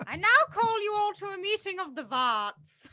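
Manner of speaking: high, loud ceremonial proclamation; mock meeting chair. Co-host summons the society, mock-ceremonial. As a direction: grandly call the meeting to order, hammed up.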